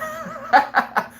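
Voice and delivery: breathy high-pitched laugh. Real, not acted. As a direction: bursts out laughing. Cracks up at own story.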